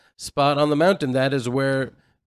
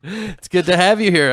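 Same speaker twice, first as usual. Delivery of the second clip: higher, louder, brighter welcome; louder radio welcome; 6 semitones higher, 6 dB louder than usual. Shown as a demonstration. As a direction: announce it like a radio host. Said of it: "when I say good to have you here".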